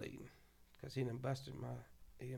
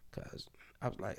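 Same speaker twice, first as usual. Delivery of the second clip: whisper; 4 semitones lower, 7 dB quieter than usual. Real, not acted. Whispered aside.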